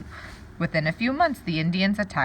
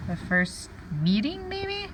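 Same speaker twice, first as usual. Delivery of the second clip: trying a word; 9 semitones higher, 3 dB quieter, slower than usual. Shown as a demonstration.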